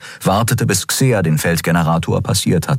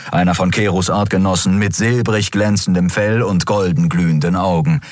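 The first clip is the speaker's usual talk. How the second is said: ordinary delivery, nothing marked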